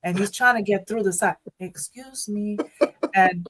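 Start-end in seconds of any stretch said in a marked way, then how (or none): 1.6-2.6 soft, distant-sounding excuse me; man heard from afar. Retold or imitated. Imitating wheelchair user trying to get through, softly. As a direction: imitate a faint polite call, much softer. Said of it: "I heard it from afar".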